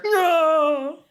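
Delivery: high, nerdy eager voice; Pete character voice. Acted. Pete insists.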